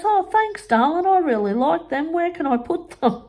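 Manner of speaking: high, sweet mock-gushing voice; mimicking mum. Mimicking mum taking the mushrooms, high and sugary. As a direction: play the oblivious mum, sugary. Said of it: "And she goes".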